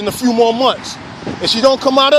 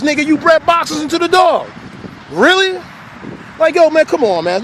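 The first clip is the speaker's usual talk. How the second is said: higher-pitched disbelief; incredulous retort; 6 semitones higher than usual. Real, not acted. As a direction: throw it back at them in exasperated disbelief. Retorting to breeders' complaint, incredulous.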